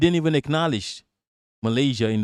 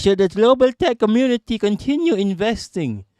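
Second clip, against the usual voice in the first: mock passive-aggressive question, pitched higher; imitating a sneering investor, higher up; 7 semitones higher than usual. Mocking the tech guy's post, passive-aggressive and higher. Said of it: "started doing some passive aggressive shit".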